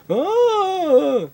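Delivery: very high mock ecstatic ah; high exhale cry. After the bong hit, high cry.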